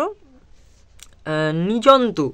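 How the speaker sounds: normal talking voice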